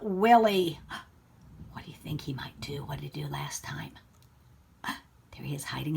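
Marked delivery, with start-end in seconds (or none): 1.7-6.0 whisper. Real, not acted. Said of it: "teacher whispers questions to children".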